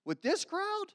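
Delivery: disbelief, pitch leaping on With this crowd; incredulous disciples. Acted. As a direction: scoff at the question, rising high. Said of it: "disciples are like".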